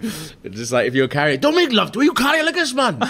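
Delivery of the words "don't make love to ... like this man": put-on Welsh accent. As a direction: shout it like a Welsh farmer. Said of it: "I love that accent you did there".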